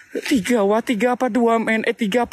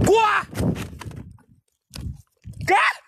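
startled yelp; 12 semitones higher than usual. Real, not acted. Startled cry in game.

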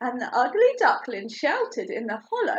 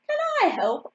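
high, hopeful little voice; little duckling voice; 10 semitones higher, faster than usual. A narrator's reading. Ugly duckling asks mole to help, piping up. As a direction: pipe up eagerly, very high.

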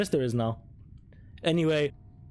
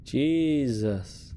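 ordinary talk

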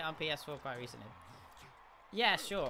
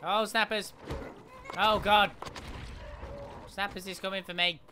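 frantic excited; 4 semitones higher, 4 dB louder than usual. Real, not acted. Frantic play in the game.

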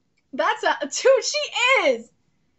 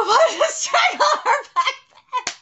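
laughing; 10 semitones higher, 6 dB louder, slower than usual. Real, not acted. Laughing delight at the scene.

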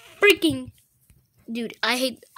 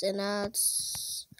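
whisper; 5 semitones lower, 13 dB quieter, slower than usual. Real, not acted. Whispered aside.

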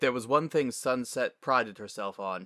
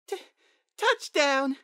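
put-on out-of-breath high child's cheer; breathless little girl's shout; panting; 5 dB louder, slower than usual. A narrator's reading. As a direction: gasp out the win, stuttering at the start. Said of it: "she panted".

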